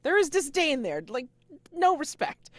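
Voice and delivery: high, loud emphatic outburst; loud insistence. Real, not acted. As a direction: burst out loudly, driving the point home. Emphatic aside about a character's scorn.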